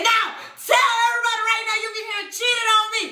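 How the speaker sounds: very high, rushed retold yell; screaming at him. Woman exposing cheater before passers-by, screaming. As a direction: demand a confession in a high shout. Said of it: "I'm still screaming at him".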